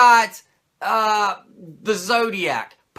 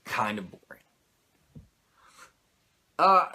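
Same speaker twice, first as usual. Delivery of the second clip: ordinary talk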